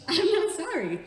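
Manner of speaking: high, loud insistence; emphatic defiance. Real, not acted. Repeats it more emphatically.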